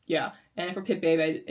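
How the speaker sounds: ordinary talk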